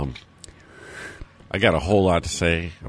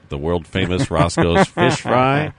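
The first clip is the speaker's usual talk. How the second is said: ad read; 2 semitones higher, 6 dB louder, slower than usual. Acted. Ad plug.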